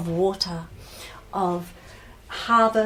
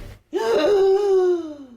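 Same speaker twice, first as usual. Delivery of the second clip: long, high voiced intake of breath; reviving gasp; 10 semitones higher, 3 dB louder than usual. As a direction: gasp back to life. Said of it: "Ada takes a breath".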